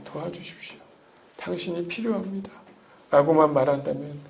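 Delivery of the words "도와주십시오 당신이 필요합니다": breathy whispered prayer, barely voiced; whispered plea. Believer begging the Lord for help, whispered. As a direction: plead softly to the Lord in a whisper.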